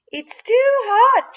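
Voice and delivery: high, loud, drawn-out pretend complaint; Mama Bear. A narrator's reading. Tara tasting pretend porridge as Mama Bear, high-voiced. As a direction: play the bear with a slow, high wail. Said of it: "first as Mama Bear".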